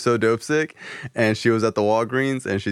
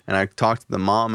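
ordinary talk

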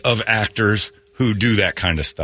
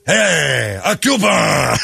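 mock Cuban shout; 6 semitones higher, much slower than usual. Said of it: "What are you talking about".

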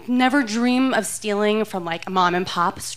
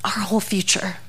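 disappointed husband. Retold or imitated. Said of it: "my husband's disappointed voice saying".

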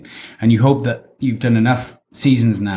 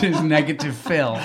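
normal talking voice

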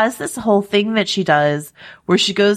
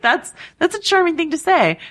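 much higher put-on swoon voice; charmed gush; 10 semitones higher than usual. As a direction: coo it like a smitten fan. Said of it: "And I was just like".

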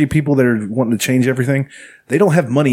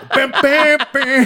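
high sung musical hits; sung beats; 14 semitones higher, much slower than usual. Retold or imitated. Mimics song's musical hits.

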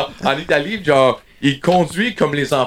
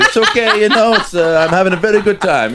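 hammed-up broken English, a bit higher and louder; 5 semitones higher, 4 dB louder than usual. To Italians in Milan, mock broken English. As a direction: play the tourist mangling his English. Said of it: "je fais le broken English".

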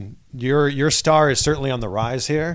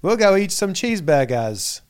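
slow, higher mock-American accent of a Brit; British fake American accent; 5 semitones higher than usual. Host mocks Brits' cheeseburger line, in badly faked American accent. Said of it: "every British person that does an American accent".

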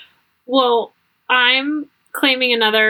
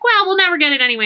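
breezy dismissal; 7 semitones higher, much faster than usual. Retold or imitated. Husband quoted brushing off.

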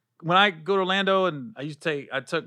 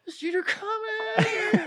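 very high, slow fanboy squeak; hammed-up fan voice; mock fanboy; much slower than usual. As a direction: stretch it out like a hopeful fan. Mocking fans asking for junior.

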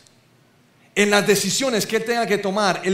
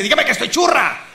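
higher, louder teasing demand through a laugh; imitating wife; 5 semitones higher, 4 dB louder than usual. Preacher's wife asking him to call her pretty, loud and laughing. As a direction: play the wife fishing for a compliment. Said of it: "mi esposa dice".

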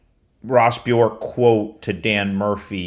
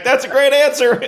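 high, louder quote, imitating amused delight; retold laughing approval; 5 dB louder than usual. Charlie Baker reacting on the panel, laughing. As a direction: quote it as if tickled by the answer. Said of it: "Charlie Baker laughed. He's like".

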